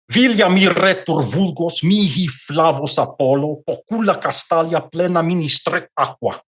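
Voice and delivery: Latin verse read with Portuguese vowels and rhythm; Portuguese-style Latin. Shown as a demonstration. Reading Ovid's Latin quote aloud to Stephen, Portuguese-style pronunciation. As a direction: read the Ovid quote the way a Portuguese speaker would pronounce Latin. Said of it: "here I'm using the correct pronunciation, which is the Portuguese".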